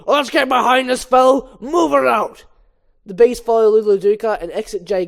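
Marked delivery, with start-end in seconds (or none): 0.1-2.4 shouted order to the bees; rallying shout. Acted. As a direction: call the troops forward with a shout. Bee leader rallying the bees.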